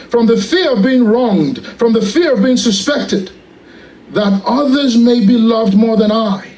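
measured litany reading, each petition falling; read prayer. Shown as a demonstration. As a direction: pray it aloud as a solemn litany. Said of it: "I'm just gonna read it to you".

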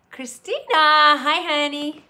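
loud, bright burst of a greeting; excited hello. Real, not acted. Host greeting Christina in chat, loud and high. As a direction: call out a warm hi to a friend.

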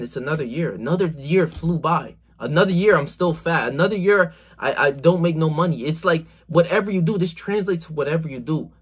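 ordinary talk

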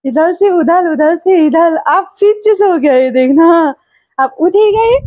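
very high, calling wake-up singsong; high wake-up calls. Real, not acted.

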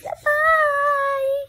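high, drawn-out bye; squealed goodbye. Real, not acted. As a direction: sing out a high, excited farewell.

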